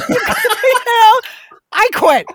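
storming off in jest, cracking up; mock huff through laughter. Host jokingly quitting the podcast, laughing mock huff. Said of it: "She's having another one of her moments".

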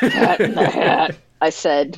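ordinary talk

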